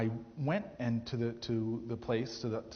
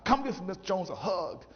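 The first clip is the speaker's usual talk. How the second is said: imitating Miss Jones; 6 semitones higher, 3 dB louder than usual. Retelling Miss Jones's coaxing.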